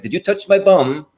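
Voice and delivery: high-pitched mock child voice; cheeky Simon voice. Retelling a Mike Myers sketch, high mock childish voice. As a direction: squeak it like a cheeky kid in a bathtub. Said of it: "he's like".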